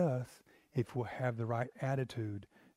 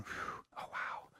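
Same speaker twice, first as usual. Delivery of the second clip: breathless, hushed whisper of wonder; awed whisper; 12 dB quieter, slower than usual. Retold or imitated. A worshipper sensing God, whispering in awe. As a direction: breathe it out in awe, barely voiced.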